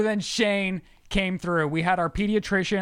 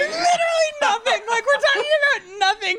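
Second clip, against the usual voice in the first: very high, strained words squeezed through laughter; helpless laughing squeal; 3 dB louder than usual. Real, not acted. Admitting to friends the chat was about nothing, squealing with laughter. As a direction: squeal it out, almost crying with laughter.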